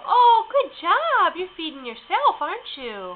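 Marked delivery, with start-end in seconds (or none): 0.1-1.3 put-on talking to a baby. Mother praising baby.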